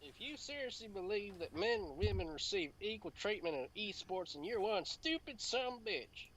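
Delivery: high-pitched southern belle reading; hammed-up southern accent, high and lilting. Takes over the southern accent reading.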